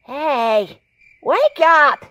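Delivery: loud urgent call; 1 shouting to wake him. Acted. Kwami yelling at sleeping Adrien, loud wake-up shout.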